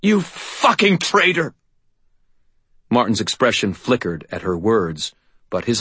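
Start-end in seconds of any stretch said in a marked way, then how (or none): 0.0-1.5 slow, high cry of betrayal; anguished accusation. A narrator's reading. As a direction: spit it out through the pain. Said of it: "her voice overflowing with pain".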